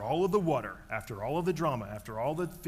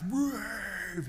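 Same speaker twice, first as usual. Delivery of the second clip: crowd cheer; 7 semitones higher than usual. Retold or imitated. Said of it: "they go nuts".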